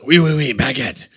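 cartoonish French accent; playful mock-French. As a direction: ham up a stock French phrase. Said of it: "Can you do a French accent?".

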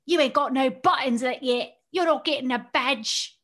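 slow mock British scolding; lightly imitating a British accent. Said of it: "they're like".

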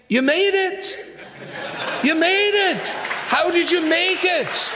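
high, amazed exclamation; astonished. Acted. Preacher questions thief on reaching heaven, astonished. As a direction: marvel with pitch leaping up.

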